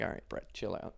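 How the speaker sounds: hushed, whispered put-down; soft whispered scolding; whispered aside. Retold or imitated. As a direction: hiss it quietly so he can't hear. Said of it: "And we're like".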